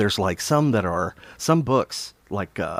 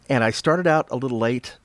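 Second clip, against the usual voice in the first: ordinary talk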